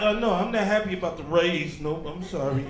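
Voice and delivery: low, apologetic climb-down; mock meek retraction. Husband taking back good news for his wife, mock meek apology. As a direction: drop low and give in sheepishly.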